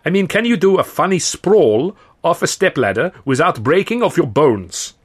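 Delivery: put-on high, emphatic European-accented director; indeterminate European accent. A narrator's reading. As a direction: spell it out in a vague European accent. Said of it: "in an indeterminate European accent".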